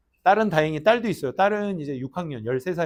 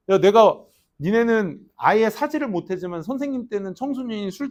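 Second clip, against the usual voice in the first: ordinary talk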